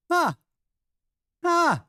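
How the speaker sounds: surprised huh. Real, not acted. Reacting to surprising chat news.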